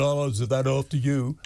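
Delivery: quoting his opponent in a put-on American accent; mock American accent. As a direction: throw his words back in a mocking American accent. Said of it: "you actually said in an American accent".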